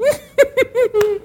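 high squeaky mocking laugh; spider laughter. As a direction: cackle like tiny spiders. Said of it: "all the spiders said".

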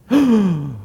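sharp surprised gasp; a quick shocked intake of breath. Acted. Caliph gasps at the secret.

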